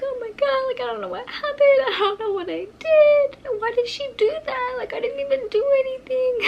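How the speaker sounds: high-pitched, whimpering imitation of sobbing; mock crying. Girl after the fight retelling her breakdown, mock crying. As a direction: wail it like tearful pleading, acted. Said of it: "like, crying".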